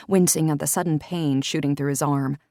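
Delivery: ordinary delivery, nothing marked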